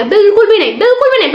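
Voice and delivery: teacher firmly denying a joke; emphatic no. Real, not acted. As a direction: stamp out the silly idea. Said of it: "बहुत गलत सोचते हो आप लोग".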